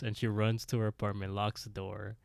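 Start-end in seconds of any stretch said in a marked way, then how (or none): none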